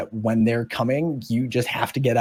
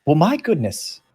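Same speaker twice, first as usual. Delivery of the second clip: creature's surprise; 5 semitones higher, 3 dB louder than usual. Acted. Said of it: "pivots around and goes".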